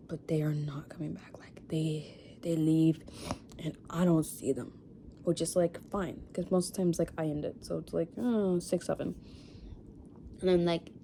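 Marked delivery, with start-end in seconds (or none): 0.1-4.7 hushed dejection. Real, not acted. Lamenting exes who never come back, real hushed whisper. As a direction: voice dropping to a whisper.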